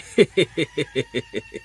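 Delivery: a big laugh that leaps up high; hearty laugh. Acted. Father jokes about the huge meal. (খাবার দেখে উচ্চস্বরে প্রাণখোলা হাসি)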